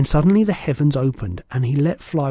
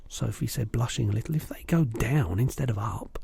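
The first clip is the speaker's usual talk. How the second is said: ordinary delivery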